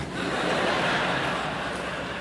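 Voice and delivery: soft real chuckle at his own joke; quiet laugh. Real, not acted.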